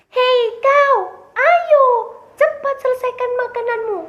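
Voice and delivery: high, very loud alarm call; urgent warning shout. Acted. Friend warning rabbit the field owner is coming, urgent shout.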